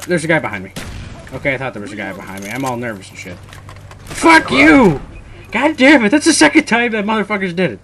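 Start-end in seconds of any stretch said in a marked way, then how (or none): 4.0-7.8 high, loud cursing; explode, then rant quickly; real angry outburst. Real, not acted. Gamer cursing the player who did it twice, angry shout. Raging at repeat killer in game.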